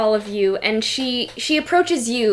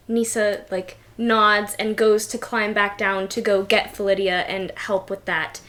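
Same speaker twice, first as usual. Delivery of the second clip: usual speaking voice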